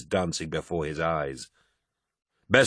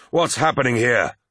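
shouted; 4 semitones higher, 3 dB louder than usual. A narrator's reading. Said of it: "shouted Horus".

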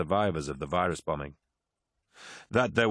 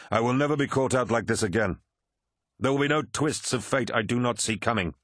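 higher, firm warlord vowing; resolute Horus; 4 semitones higher than usual. Acted. Horus vows never to be caught out, resolute. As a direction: swear it with angry resolve.

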